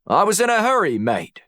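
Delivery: put-on Aussie accent, starting very high and dropping on "mate"; Australian accent. A narrator's reading. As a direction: plead casually as a put-on Australian. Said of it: "summoned his best Australian accent".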